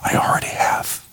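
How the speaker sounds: soft unvoiced whisper; whispered voice of God. God answering the weeping minister, hushed whisper. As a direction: breathe it like a still small voice. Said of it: "in a still small voice in his heart".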